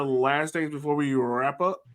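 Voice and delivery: usual speaking voice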